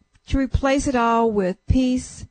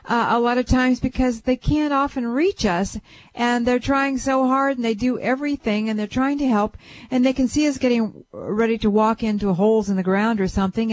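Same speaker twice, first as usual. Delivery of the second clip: normal talking voice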